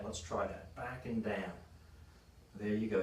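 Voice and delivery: soft spoken cue and praise; quiet coaching cue. Real, not acted. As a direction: murmur the cue, then quiet approval. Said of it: "coach cueing client mid-rep".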